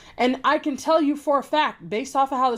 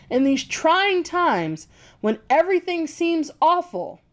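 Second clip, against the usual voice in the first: "In these trying times" dragged slowly, then "everything" leaned on; mocking slow quote; slow pointed reading; 4 semitones higher, slower than usual. Shown as a demonstration. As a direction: read it out slowly to expose it. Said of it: "that's what they meant by trying".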